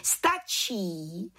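calling out. A narrator's reading. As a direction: stop the test as head witch. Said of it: "zvolala vrchní čarodějnice".

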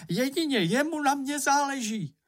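high, sustained cry of complaint; upset outburst. A narrator's reading. Upset character insisting only he cares about them, high plaintive outburst. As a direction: pitch it high all through, as an upset story character.

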